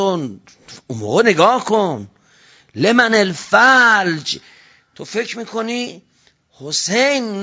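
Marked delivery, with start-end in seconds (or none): 2.7-4.4 slow emphatic recitation. Acted.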